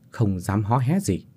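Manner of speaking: ordinary delivery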